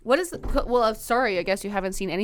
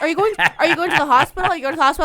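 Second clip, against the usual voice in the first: mock alarmed questions; 8 semitones higher, 8 dB louder, much faster than usual. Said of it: "Everyone's like".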